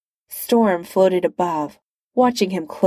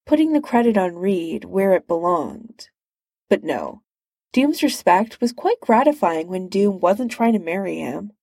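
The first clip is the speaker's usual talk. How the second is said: usual speaking voice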